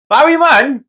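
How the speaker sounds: ordinary talk, nothing marked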